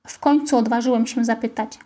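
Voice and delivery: usual speaking voice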